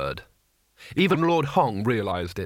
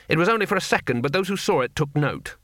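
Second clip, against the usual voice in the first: usual speaking voice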